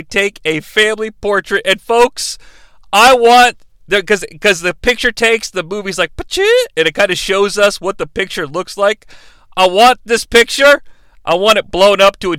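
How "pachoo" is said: mock shutter sound. Camera shutter sound effect.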